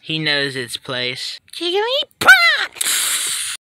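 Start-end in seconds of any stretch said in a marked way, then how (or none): 1.5-3.5 very high, loud, drawn-out creature cry; Jigglypuff battle cry. Acted. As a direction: squeal the name slowly, building to a burst. Jigglypuff attacks in a skit.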